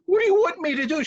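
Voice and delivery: high, pleading protest; exasperated cry from a henpecked husband; flustered husband. Acted. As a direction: throw up a helpless high-pitched question.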